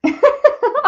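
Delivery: sudden high loud laugh; burst of laughter. Real, not acted. Crafter laughing at her own packaging hoard. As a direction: let a real laugh burst out.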